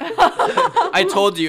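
laughing. Real, not acted. Laughing at his own joke.

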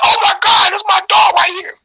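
thrilled yell, high up; excited shout. Retold or imitated. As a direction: shout it like you just spotted your friend. Excited hug, retold shout.

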